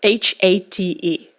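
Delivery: slow, letter by letter; measured letter-by-letter emphasis; emphatic spelling out. Real, not acted. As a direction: spell it out deliberately, each letter separate.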